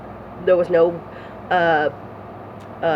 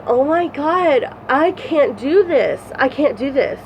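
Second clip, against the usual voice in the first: despairing; 7 semitones higher, slower than usual. Retold or imitated. Remembered despair in the cell.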